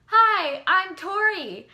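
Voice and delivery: loud, high starstruck hello, slowing on her name; excited greeting. Retold or imitated. Fan greeting her idol, loud high excited hello.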